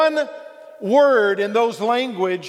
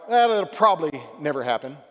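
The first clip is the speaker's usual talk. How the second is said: casual dismissive never; 5 semitones lower than usual. Retold or imitated.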